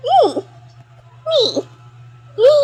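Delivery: tiny high squeaky syllables; short high-pitched squeaks; different voice. Shown as a demonstration. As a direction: show off a silly little high voice. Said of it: "kaya ko ibang boses".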